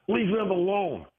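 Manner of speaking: high, put-on voice of the woman; mimicked protester. Imitating the protester snapping at him. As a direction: squeak it like a scolding stranger. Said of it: "she turns around and says".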